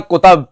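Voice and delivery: कुतब ending in -tab; imitated mispronunciation. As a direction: mimic the misreading ku-TAB in कुतब. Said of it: "हजरत पढ़ते हैं".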